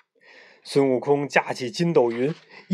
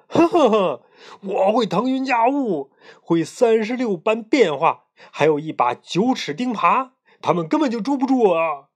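higher, chuckling monster boast; boastful pig demon; 6 semitones higher than usual. A narrator's reading. Pig demon brags to his bride, boastful higher voice.

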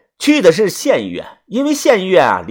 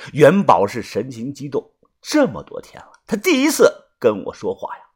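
ordinary delivery

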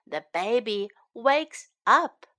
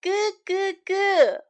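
put-on high baby babble; cooing baby; 6 semitones higher, slower than usual. Storyteller voices the baby being held, high cooing. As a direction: coo in short high syllables like a baby. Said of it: "The baby says".

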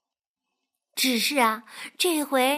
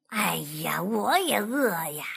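weary fox; 6 semitones lower than usual. Acted. Fox sighing reply.

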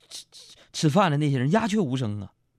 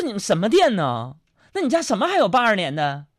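indignant customer; 6 semitones higher, 3 dB louder than usual. Retold or imitated. Acted indignant customer complains.

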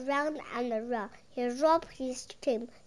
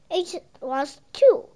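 insisting; 5 semitones higher, 9 dB louder than usual. A narrator's reading. Children arguing about monster.

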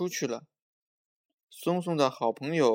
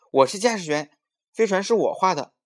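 put-on higher, much louder child's protest; indignant insistence; 8 semitones higher, 9 dB louder than usual. Maomao disputing who gets to be pilot, indignant and loud. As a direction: insist loudly on your claim.

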